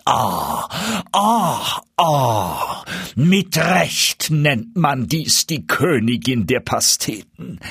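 slow, savouring sighs of pleasure; delighted duke. A narrator's reading. Duke praises the pie to his guest, savouring. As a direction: relish every bite aloud.

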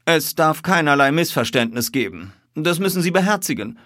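high, loud, insistent; emphatic detective's admonition; stern warning. A narrator's reading. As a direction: impress it firmly on a cowed man. Detective warns the cowed man.

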